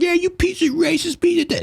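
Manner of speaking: very high, put-on jeering voice; mock agitator. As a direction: sneer it like a troublemaker baiting the crowd. Imitating agitators cursing at Antifa.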